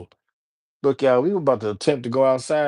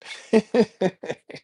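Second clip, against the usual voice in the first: real laugh; 8 semitones higher than usual. Real, not acted. Laughs after plugging his music.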